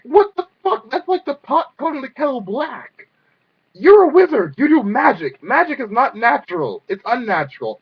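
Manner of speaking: loud, high-pitched outraged tirade; exasperated rant. Acted. Fan calling out a hypocritical wizard, loud exasperated rant. Mock-arguing with the cartoon wizard.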